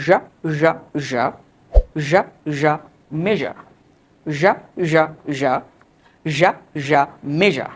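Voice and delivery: repeated "ঝা" with buzzed zh, then "মেজা" as MEH-zhuh; rhythmic zh practice on "ঝা" and "মেজা"; zh practice drill. Shown as a demonstration. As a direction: drill "ঝা" as zhah, landing on "মেজা". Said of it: "দেখো সেটা কীভাবে বলতে পারে".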